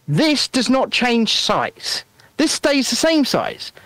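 each word drawn out slowly; slow it down to make the point; slow and emphatic. Shown as a demonstration. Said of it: "demo with the can".

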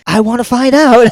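put-on high-pitched eager old lady; mock eager curiosity. Older woman keen to meet the aliens, mock eager.

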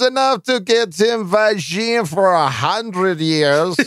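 put-on high, drawn-out comic Kazakh accent; mock Kazakh accent. Host reading a listener's joke question, mock Kazakh accent. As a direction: play a gleeful fake Kazakh character.

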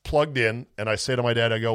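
ordinary talk

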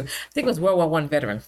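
ordinary delivery

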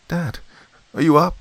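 lightly voiced girl, croaking up; hoarse little call at the door; croaked call. A narrator's reading. Girl calling to her father through a locked door, hoarse. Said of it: "I croaked".

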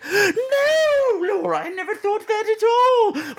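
high, appalled genteel lady; shocked British lady. Retold or imitated. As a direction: recoil in prim horror. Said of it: "the conversations are always like".

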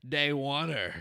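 loud mock Aussie; Australian accent attempt. Host reading out a fan's message, mock Australian accent. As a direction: project it in a hammed-up Australian accent. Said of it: "That's my best attempt at an accent".

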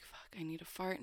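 hushed panicked whisper; trapped in the family car, whispering to herself. Retold or imitated. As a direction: whisper it so nobody in the car hears. Secret thought in crowded car.

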